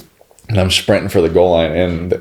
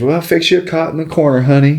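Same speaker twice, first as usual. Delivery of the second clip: Texas family twang; 7 semitones higher than usual. Retold or imitated. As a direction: imitate a Texas relative fussing over you. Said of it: "like, everything was y'all".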